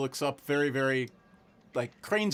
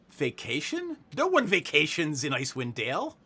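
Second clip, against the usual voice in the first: quizzical; 3 semitones higher, 3 dB louder than usual. Acted. Deputy puzzled by vacationers, quizzical. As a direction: question it with mild disbelief. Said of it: "She looks at you quizzically".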